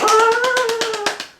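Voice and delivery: very high, loud impressed cry; amazed Japanese lady. Retold or imitated. Japanese lady impressed by my basic reading, high amazed cry. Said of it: "she's like".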